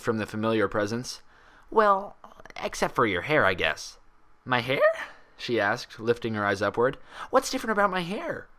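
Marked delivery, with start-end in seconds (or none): 1.7-4.0 lightly voiced young mare, gentle; a touch of relief in her voice; Twilight voice. Twilight greeting her friend, lightly voiced and relieved. 4.4-4.8 lightly voiced bubbly pony, puzzled; a hint of Pinkie's bounce. Pinkie asking Twilight about her hair, lightly puzzled. 7.3-8.5 high, bubbly pony voice, puzzled; Pinkie voice. Acted. Pinkie asking Twilight about her hair, high and bubbly.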